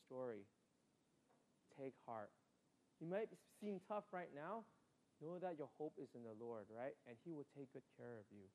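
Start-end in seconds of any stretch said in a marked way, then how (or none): none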